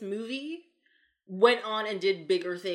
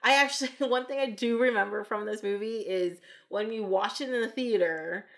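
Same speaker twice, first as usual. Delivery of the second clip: ordinary talk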